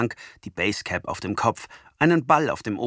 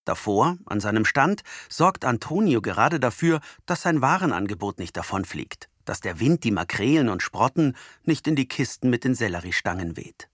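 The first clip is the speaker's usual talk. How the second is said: ordinary delivery, nothing marked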